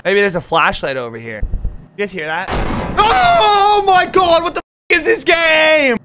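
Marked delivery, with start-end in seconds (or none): 3.0-6.0 loud high terrified yelling; scared horror game streamer. Real, not acted. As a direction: scream it in real panic. Jump scare, music blares.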